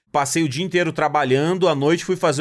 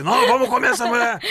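a mock-angry voice, higher; angry inner voice; 6 semitones higher than usual. The angry emotion in his head pushing him on, mock anger. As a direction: ham up a cartoon angry emotion egging him on. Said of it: "Bravão assim".

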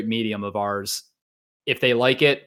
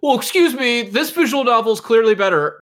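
high, pushy mock of an offended fan; a snooty fan objecting that his visual novel is better; 10 semitones higher, 6 dB louder than usual. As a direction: sneer it like a know-it-all fan correcting you. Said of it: "I'm sure someone's like".

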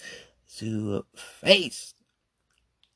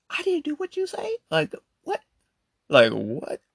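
very high disbelieving squeak; outraged disbelief at being blamed; incredulous. Acted. Incredulous rant.

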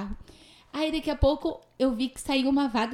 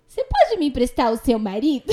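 louder, breaking into laughter; laughing retold request; 2 semitones higher, 6 dB louder than usual. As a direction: ask it while starting to laugh. Laughs through her retold request. (pedido contado às risadas)

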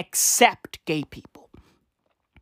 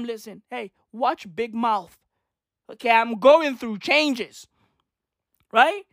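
normal talking voice

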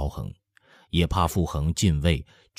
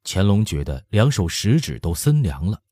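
usual speaking voice

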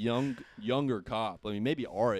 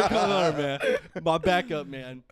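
loud laughing call-out; laughing cheer; 3 semitones higher, 7 dB louder, slower than usual. Real, not acted. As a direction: cheer a friend while laughing. Laughing shout to friend.

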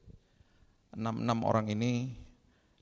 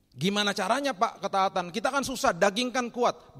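high, loud mock complaint; whiny objection; 9 semitones higher, 6 dB louder than usual. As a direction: protest to the pastor like it's all too hard. Voicing congregants' pleading objection.

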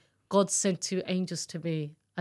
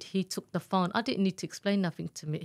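normal talking voice

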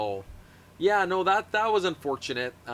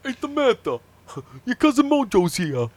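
mock Italian accent; 7 semitones higher, 6 dB louder than usual. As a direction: announce it like a neighborhood guy.

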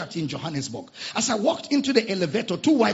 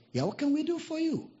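high, soft lady at the door; a hint of a polite woman; lightly voiced woman; 10 semitones higher, 6 dB quieter than usual. Lady's voice in story.

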